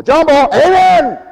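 loud rising punch after the drone; tone change. Shown as a demonstration. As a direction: jump up and call out Amen. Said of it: "changes in the tone of my voice".

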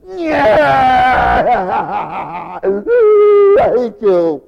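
loud mock yell of a cranky kid; bratty child. Tired child yelling at parent, loud brat.

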